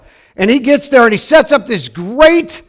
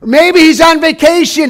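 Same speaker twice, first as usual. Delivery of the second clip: higher, sarcastic jab at the false prophets; mocking taunt; 8 semitones higher, 5 dB louder than usual. Elijah taunts the prophets of Baal, mocking loud. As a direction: tease them like Elijah, loud and high. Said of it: "he says".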